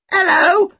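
very high, loud parrot squawk; piercingly high bird call; parrot shout. A narrator's reading. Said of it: "someone shouted. I think it was a parrot".

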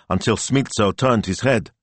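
plain reading voice

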